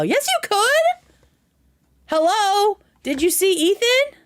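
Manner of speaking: high-pitched playful objection; excited protest. Real, not acted. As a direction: jump in to contradict him, thrilled. Cuts in to contradict his claim.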